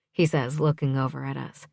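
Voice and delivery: plain reading voice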